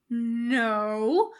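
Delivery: a firm, growled no; growled refusal. A narrator's reading. Bones refusing his friend Jim, growling. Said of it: "Bones growls".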